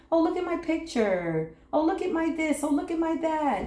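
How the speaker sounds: playfully mock a kid showing off; attention-seeking child. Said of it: "we love attention".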